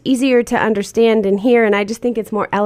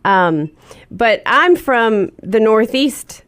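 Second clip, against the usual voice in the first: normal talking voice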